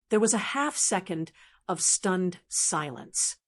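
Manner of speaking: usual speaking voice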